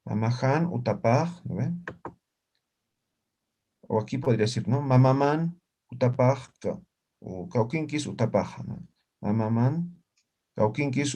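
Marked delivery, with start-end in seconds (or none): none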